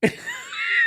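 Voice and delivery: high-pitched burst of laughter; real laugh. Real, not acted. Amused at the rebuke in the story, high laugh.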